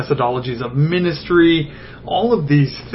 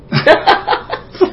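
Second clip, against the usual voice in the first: self-mocking laugh; 13 semitones higher, 4 dB louder than usual. Real, not acted. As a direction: burst of high breathy laughter. Laughs at his own arrogance.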